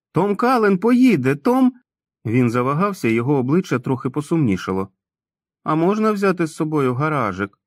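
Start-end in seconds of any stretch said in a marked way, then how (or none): 0.1-1.7 much higher, louder, slow joyful cry; overjoyed shout. Acted. Tom overjoyed at going along. 5.6-7.6 put-on higher, cautious childlike question; warily. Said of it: "сторожко спитав Том".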